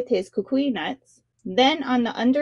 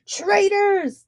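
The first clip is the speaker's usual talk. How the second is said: higher, angry shout; 9 semitones higher than usual. A narrator's reading. Shark king shouts at his followers in anger. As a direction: hurl the accusation out. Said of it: "the king shouted".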